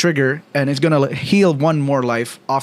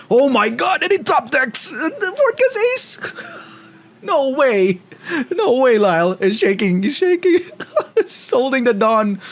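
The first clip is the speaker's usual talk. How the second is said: esports caster; 10 semitones higher than usual. Real, not acted. Top-deck shocks caster.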